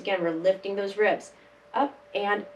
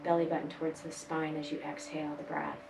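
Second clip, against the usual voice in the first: guided breathing; 3 semitones lower, 10 dB quieter than usual. Acted. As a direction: ease them through each breath gently. Soft guided breathing instructions.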